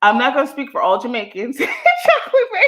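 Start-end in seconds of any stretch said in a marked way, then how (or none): 1.6-2.7 much higher, slower, giggling through the name; real laughter; laughing. Real, not acted. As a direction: sing out the name while cracking up. Laughing greeting to a viewer.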